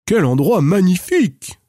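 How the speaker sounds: high, amazed royal exclamation; king exclaims. A narrator's reading. King arrives at the castle, high amazed exclamation. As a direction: marvel at the castle aloud. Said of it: "s'exclama-t-il".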